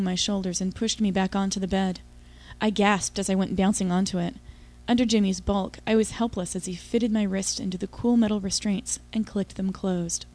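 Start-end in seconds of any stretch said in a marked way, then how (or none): none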